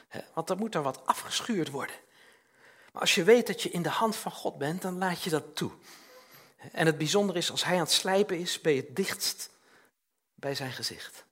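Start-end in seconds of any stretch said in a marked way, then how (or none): none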